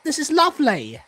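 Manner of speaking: high mock Italian accent. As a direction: mimic them with a hint of Italian accent. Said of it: "speaking in an Italian accent".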